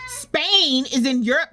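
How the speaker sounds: ordinary delivery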